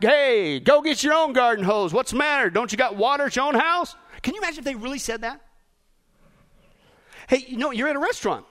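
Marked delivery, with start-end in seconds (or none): 0.0-3.9 loud, indignant firefighter shouting back; mock shout back. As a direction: holler it back as a fed-up firefighter. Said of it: "they shout back".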